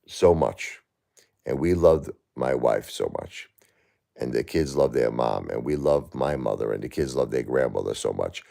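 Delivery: tender, heavy sorrow; grieving softly. Real, not acted. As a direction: let the loss pull the voice down. Speaking of his late wife.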